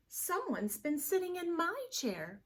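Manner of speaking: an indignant mama bear; Missus Blair. Acted. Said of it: "said Missus Blair".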